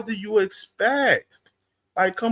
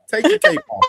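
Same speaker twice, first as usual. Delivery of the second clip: louder teasing call-out; 5 semitones higher, 6 dB louder, faster than usual. Retold or imitated. Teasing a fighter.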